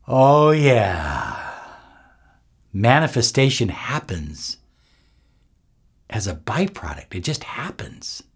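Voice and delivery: hushed, fading to a breath; airy breathy mystical healer. Acted. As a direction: let it melt into a whisper.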